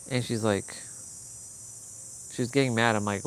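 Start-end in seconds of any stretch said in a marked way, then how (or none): none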